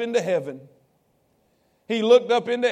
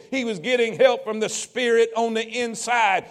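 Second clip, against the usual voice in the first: ordinary talk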